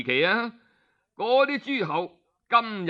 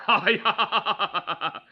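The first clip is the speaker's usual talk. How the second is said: a short, cold, mocking laugh; scornful laugh; 3 semitones higher than usual. The duke laughs coldly at the lying minister, mocking. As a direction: laugh him off before striking.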